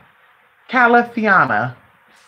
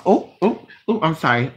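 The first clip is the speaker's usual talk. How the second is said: ordinary talk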